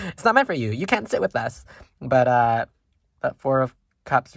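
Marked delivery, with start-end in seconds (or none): 0.1-0.7 higher, louder snooty brush-off; mock mean girl. Reader teases viewers like a mean girl, snooty and higher. 0.7-1.7 mock mean girl. Reader teases viewers like a mean girl, snooty and higher.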